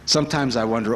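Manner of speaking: ordinary delivery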